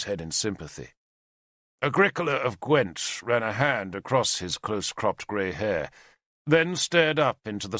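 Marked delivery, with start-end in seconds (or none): none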